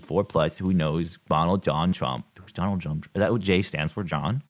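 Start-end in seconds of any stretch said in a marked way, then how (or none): none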